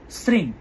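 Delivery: শ্রীম said as sring, final m turned into ng; nasal ending. Shown as a demonstration. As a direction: end শ্রীম on a soft ng. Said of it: "দীর্ঘি অনুস্বার".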